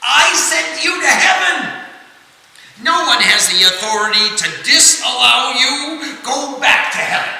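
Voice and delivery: high, loud commanding call. Acted. Vishwamitra calls out to stop the fall, loud command. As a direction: command him to stop falling. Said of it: "Vishwamitra called out".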